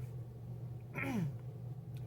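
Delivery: quick dry throat clear. Real, not acted. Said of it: "Excuse me, my allergies".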